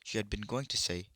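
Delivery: ordinary delivery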